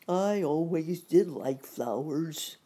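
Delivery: slow lion voice; a hint of the Lion, unhurried; lightly voiced Lion. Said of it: "said the Lion".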